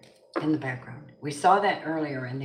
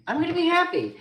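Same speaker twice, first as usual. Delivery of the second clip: high-pitched, exuberant declaration; affirmation; 14 semitones higher, 4 dB louder, faster than usual. Shown as a demonstration. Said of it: "It's a affirmation".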